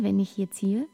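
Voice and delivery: normal talking voice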